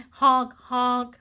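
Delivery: "Honk, honk" as a loud car horn. Acted. Teasing the kids with a wrong, loud honk. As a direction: blare it like a taxi horn. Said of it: "That's not what the pigeon says".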